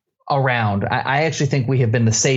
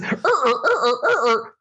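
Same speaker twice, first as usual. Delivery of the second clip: high, repeated "er" squeaks; comic scrubbing sound effect; imitated rubbing noise; 8 semitones higher than usual. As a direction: mime frantic rubbing with the voice. Mimics frantic eye rubbing.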